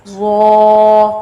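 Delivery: زو thick and full; heavy zo. Shown as a demonstration. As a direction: fill زو out thick. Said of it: "یہ بھی پر کر کے پڑھے جائیں گے".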